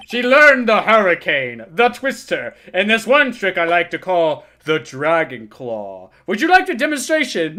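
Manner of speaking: a hint of a rattled old man ranting; crude old lawyer. Old lawyer ranting about the girl, mildly worked up. Lawyer boasts, reacts loudly.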